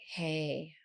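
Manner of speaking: hushed, soothing greeting; soft voice. Retold or imitated. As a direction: ease in softly like a calming partner. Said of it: "you come in with that voice".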